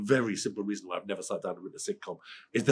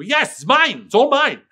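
much higher and louder, hammed-up gloating; mock possessive boast; 16 dB louder, slower than usual. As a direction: crow over it like a cartoon miser. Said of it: "Like that".

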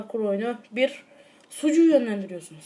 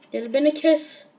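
ordinary talk, nothing marked

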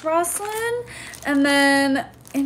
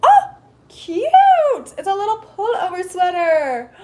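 much higher and louder, squealing with delight; gushing squeal; 8 semitones higher, 6 dB louder than usual. Real, not acted. Finding a sweater in a fan package, squealing with delight.

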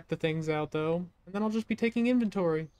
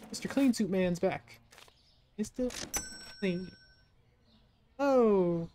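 usual speaking voice

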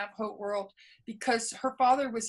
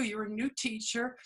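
usual speaking voice

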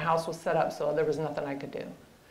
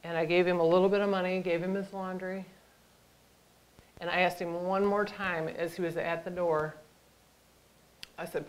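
normal talking voice

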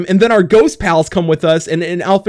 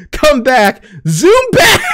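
laughing squeal; 7 semitones higher, slower than usual. Real, not acted. Zooming gag with laughter.

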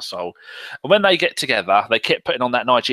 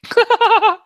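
loud, high-pitched real laugh; burst of laughter; 6 dB louder than usual. Real, not acted. Co-host reacting to the Twitter fight story, loud high laughter.

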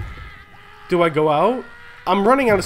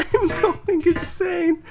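high, crying laugh through the words; laughing despair; 8 semitones higher than usual. Real, not acted. Gamer trapped inside laughing in despair. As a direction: laugh on the edge of tears.

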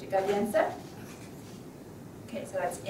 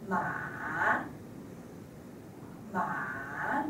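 "ma" dipping low then climbing; falling-rising tone; 6 semitones lower, 7 dB quieter than usual. Shown as a demonstration. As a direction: scoop "ma" down and back up, third tone. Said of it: "a falling and rising tone".

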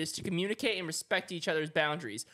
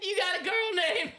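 high, squealed taunt through laughter; laughing tease; 9 semitones higher than usual. Real, not acted. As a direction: tease while cracking up.

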